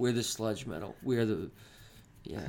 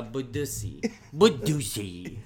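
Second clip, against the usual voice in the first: silly voice; 4 dB louder than usual. Pretend. Silly stretched made-up word.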